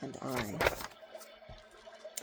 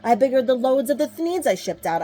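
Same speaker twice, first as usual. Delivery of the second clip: boastful build-up; 5 semitones higher, 6 dB louder than usual. A narrator's reading. Onceler boasting growth.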